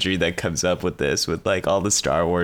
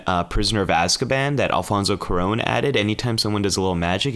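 normal talking voice

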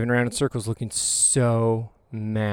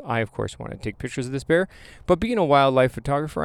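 usual speaking voice

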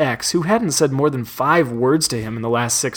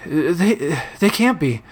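high breaking disbelief; distraught boy voice; 3 semitones higher, slower than usual. Acted. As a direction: stammer in disbelief. Monty in disbelief.

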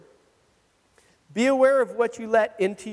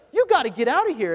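re-enacted quick, high-pitched demand to leave; retold aggressive order; 6 semitones higher, faster than usual. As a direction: order them off, acting out the aggression. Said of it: "I got all aggressive".

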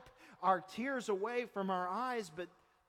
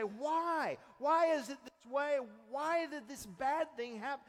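grieving voice; 6 semitones higher, 4 dB louder than usual. Retold or imitated. Voicing a grieving person's question.

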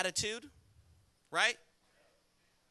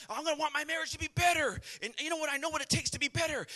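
higher-pitched, quick mock resolution; earnest vow; 8 semitones higher, faster than usual. Husband vowing a better marriage, mock-earnest. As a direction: imitate someone eagerly vowing to improve.

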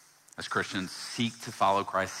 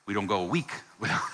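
ordinary talk, nothing marked

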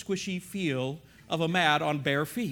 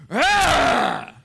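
loud, harsh mock growl; imitated growl; 5 semitones higher, 6 dB louder than usual. Bald gym guy growls at the storyteller. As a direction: roar it out like a territorial brute. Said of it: "he just growled at me".

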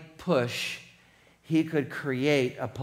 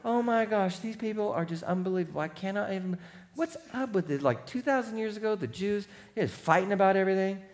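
soft, higher, hurried mock disbelief; incredulous inner voice; 4 semitones higher, 7 dB quieter, faster than usual. Listener thinking about people fighting, soft incredulous aside. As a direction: think aloud under your voice, appalled. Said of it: "And you think to yourself".

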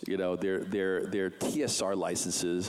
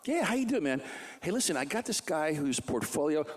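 chatty phone voice, pitched up; voicing Art on the phone; 9 semitones higher than usual. Art calls his friend Jim, high and chummy.

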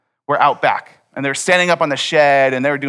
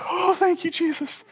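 much higher, breathy rush of relief; relieved sigh of thanks; 4 dB quieter than usual. Retold or imitated. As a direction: exhale it like you just survived. Relief after armed guys leave.

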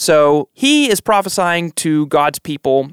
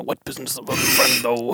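Chigurh impression; 5 dB quieter than usual. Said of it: "There was no Anthony Chigurh".

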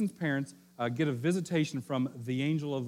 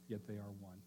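"Yet they are one" said again much softer, almost under the breath; hushed repeat; 5 semitones lower, 16 dB quieter than usual. Shown as a demonstration. Preacher repeats the point to the congregation, hushed and soft.

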